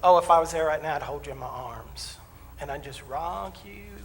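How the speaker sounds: imitating a counselor's syrupy comfort; mock soothing. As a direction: coo it slowly as if rocking someone. Counselor mock-comforts him on the phone.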